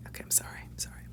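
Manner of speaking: breathy whispered sorry, barely voiced; sheepish whisper; whispered apology. Real, not acted. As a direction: whisper it through a giggle. Giggling apology after the fanfare.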